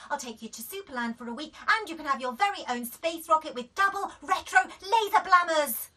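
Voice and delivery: very fast, building to loud; frantic mum. A narrator's reading. Mum desperately rattling off bribes. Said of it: "said Daisy".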